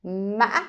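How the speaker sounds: ma broken then ending high; ngã tone on ma; Vietnamese tumbling tone. Shown as a demonstration. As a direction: trip on ma then finish high. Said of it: "the ending actually is very high pitch".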